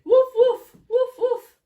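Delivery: high, loud woofs; punchy playful dog bark; barking sheepdog. Acted. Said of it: "he's a sheepdog, and he's saying".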